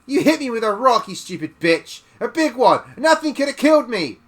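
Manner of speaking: put-on bully screaming at the old woman; furious shriek. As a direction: scream it at her in pain.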